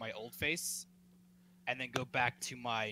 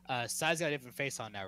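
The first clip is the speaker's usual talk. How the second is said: ordinary delivery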